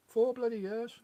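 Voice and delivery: high-pitched, incredulous exclamation; real disbelief; amazed. Real, not acted. Amazed at four years passing.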